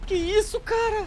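high-pitched stunned question; stunned disbelief. Real, not acted. Player after the plane crash, stunned disbelief.

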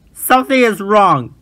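loud high shout. A narrator's reading. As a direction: shout the alarm. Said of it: "he shouted".

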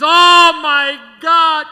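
loud, high, slow gasp of delight; mother on the phone, overwhelmed; mother gushing. Retold or imitated. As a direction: gush like a thrilled Italian mama. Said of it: "She says".

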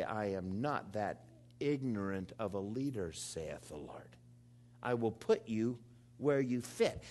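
low and soft, sinking to a hush; voice of God. Acted. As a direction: speak as God, quietly sure. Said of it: "And God would say".

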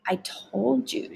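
slow, hushed, barely voiced aside; mouthed. A narrator's reading. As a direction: mouth it softly, drawing it out. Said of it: "she mouthed".

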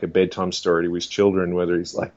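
normal talking voice

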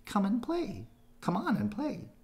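high bouncy bunny voice; an eager little rabbit begging to play; Thumper voice. A narrator's reading. Thumper coaxing Bambi to play, high eager voice.

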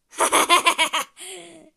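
loud laugh in a put-on voice. Toy character laughs off a fight challenge, loud laugh.

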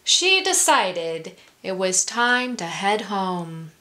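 ordinary delivery, nothing marked

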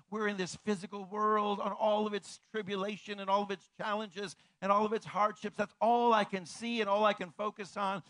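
preacher's swelling cadence; building sermon intensity. Acted. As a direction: build the point toward a climax.

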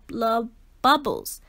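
ordinary delivery

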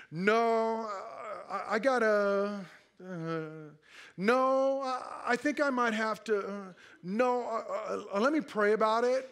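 halting no, padded with drawn-out uhs; hesitant excuse-making. Acted. Churchgoer dodging a request with an explanation, hesitant and stalling. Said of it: "We say no with an explanation".

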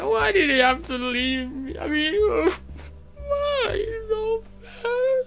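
high, wailing fake cry; mock heartbroken sobbing. Imitating a dumped friend crying over her ex, high sobbing wail. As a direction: whimper it like a jilted girl, voice cracking up high. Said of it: "you're crying and you're bawling".

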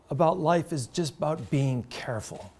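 ordinary talk

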